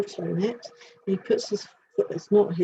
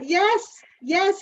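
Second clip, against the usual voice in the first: much higher, loud eager yeses; delighted showman; 11 semitones higher, 4 dB louder than usual. Retold or imitated. Voicing the showman dog, high and eager. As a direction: cheer it like a proud showman.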